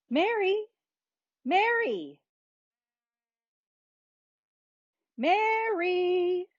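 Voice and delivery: high-pitched, drawn-out searching calls; calling out. A narrator's reading. Mom and Dad searching for Mary, calling out. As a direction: call the name across the neighborhood, stretching it. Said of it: "Their calls grew louder and louder".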